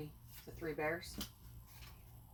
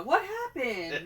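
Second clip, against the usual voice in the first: higher, slow laughing question; mock bewildered kid; 8 semitones higher, 5 dB louder, slower than usual. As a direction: play it puzzled, laughing. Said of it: "Kind of like a".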